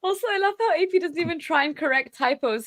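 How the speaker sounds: normal talking voice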